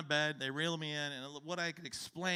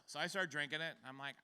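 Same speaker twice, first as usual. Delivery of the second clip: ordinary talk, nothing marked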